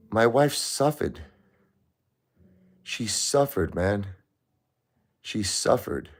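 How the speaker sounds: pained, rising voice over her suffering. Real, not acted. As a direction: let the pain lift the pitch.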